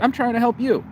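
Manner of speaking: high-pitched, pleading objection; exasperated protest. Retold or imitated. Retelling his plea after she blocked him, exasperated.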